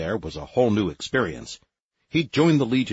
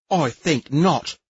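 slow, clipped higher refusal; cold refusal; 8 semitones higher, 5 dB louder, much slower than usual. A narrator's reading. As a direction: dismiss it icily and slowly.

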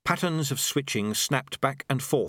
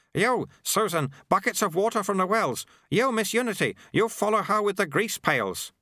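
urgent sweeper giving orders; 8 semitones higher than usual. Acted. Sweeper sending two women for water and grease, high and urgent. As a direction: give orders as the sweeper, pitched well up.

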